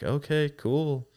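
high, drawn-out humoring reply; mock-agreeable, stretched out; retold skeptical acknowledgement. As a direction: humor a bragging friend, slow and high. Retold doubtful reply to friend's bragging.

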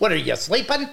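high mock-nagging deer voice; scolding talking deer. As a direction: nag up high as a comic deer. Deer scolding its mate in skit.

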